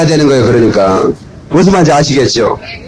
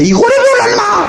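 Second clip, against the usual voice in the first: much higher, shrill-pitched scolding yell; scolding neighbor woman. Retold or imitated. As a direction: yell it like an auntie scolding her kids. Neighbor woman scolding her kids. (아이들을 혼내는 옆집 아줌마의 높은 꾸지람)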